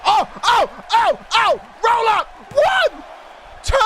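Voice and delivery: losing it over a near-fall; excited shouting. Real, not acted. Near-fall during the match.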